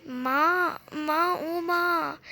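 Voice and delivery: drawn-out pained calling for mother; cry of pain. Acted. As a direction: moan for mother slowly, hurting. Mohan cries out in pain.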